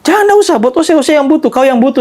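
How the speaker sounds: put-on high insistent refusal, Ambon accent; refusing. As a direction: push the money back, insisting. Old man refuses the money.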